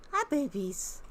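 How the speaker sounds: high cooing at the pets. Real, not acted. Cooing at her raccoons through the window. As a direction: gush over them like babies.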